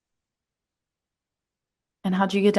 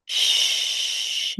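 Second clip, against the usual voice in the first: hushing. Real, not acted. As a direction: shush them quickly. Hushing talk of Duke.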